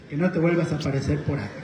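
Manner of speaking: low, soft and fast; quick muted warning after the ordeal; retold parting warning. Son to the departed toad, low hurried mock warning. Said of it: "ya después digo yo".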